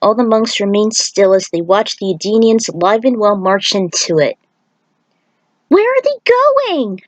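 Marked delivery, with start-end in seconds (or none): 5.7-7.0 put-on much higher, slow childlike voice; high, slow little kid asking a question; curious kid voice. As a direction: ask wide-eyed like a young boy. The kid asks about the Edenians.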